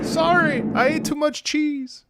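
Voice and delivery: very high, drawn-out apology; mock apology. Streamer apologizes to a fan after farting, high mock apology.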